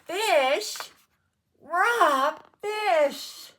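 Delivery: very loud, high-pitched shriek; storybook scream of revulsion; horrified scream. A narrator's reading. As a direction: scream each word in disgust. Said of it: "she screamed".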